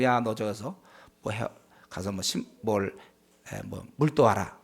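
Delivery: a hint of a parent calling the kid over; voicing a parent. Preacher imitating a parent sending a child for water, low mock parent voice.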